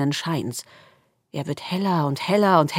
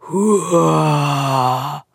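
darkness huffing; 5 dB louder than usual. A narrator's reading. The darkness huffs again, a bit less loud. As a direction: breathe a big huff from the dark. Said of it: "macht die Dunkelheit wieder".

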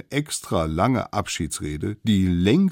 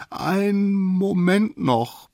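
high, slow, drawn-out plea; stretched-out high begging; pleading; 13 semitones higher, much slower than usual. Acted. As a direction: beg for a moment in a little squeaky voice. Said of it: "bat der Allerkleinste".

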